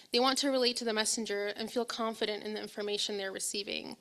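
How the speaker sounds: ordinary delivery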